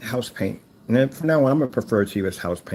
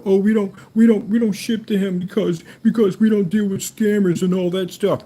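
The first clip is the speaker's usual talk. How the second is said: higher, louder sneering whine imitating rival; mock the crybaby rival; mocking whiny Karen; 7 semitones higher, 3 dB louder than usual. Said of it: "go cry Karen and go".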